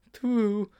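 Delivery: put-on high boyish character voice; high schoolboy agreement; agreeing Sponge. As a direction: concede it in a high little voice.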